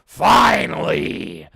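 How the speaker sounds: huge, long-drawn announcer bellow; mock-epic shout.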